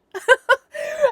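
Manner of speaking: real amused laugh, high pitched; quick delighted laugh; burst of high laughter. Real, not acted. Laughs at her own story.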